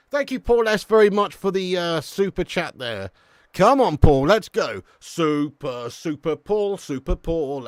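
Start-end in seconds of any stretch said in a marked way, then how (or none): 3.5-7.7 loud, punchy cheer; rallying shout. Real, not acted. Streamer thanks Paul for a super chat, rallying shout. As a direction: holler encouragement to get the crowd going.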